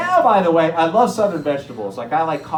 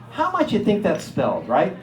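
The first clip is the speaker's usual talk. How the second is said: usual speaking voice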